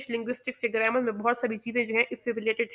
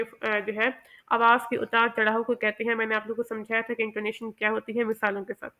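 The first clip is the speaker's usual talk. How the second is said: normal talking voice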